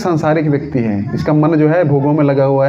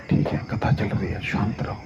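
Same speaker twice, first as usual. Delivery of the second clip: very soft, low, half-whispered reassurance; unruffled hush; 8 semitones lower, 11 dB quieter than usual. Acted. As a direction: hush the messenger calmly, barely voiced. आग की खबर पर शांत श्रोता. (बहुत धीमी, शांत, फुसफुसाती आवाज़)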